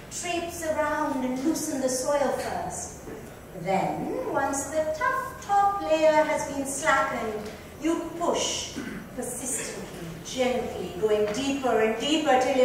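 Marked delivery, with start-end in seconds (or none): none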